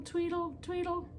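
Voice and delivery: high chirpy birdsong tweedles; chickadee tweet. A narrator's reading. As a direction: chirp it like a tiny bird. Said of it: "their song had sounded like".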